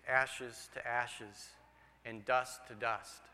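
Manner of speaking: slow, low and soft, like a funeral pastor; solemn pastor's quote. Retold or imitated. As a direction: intone it gravely.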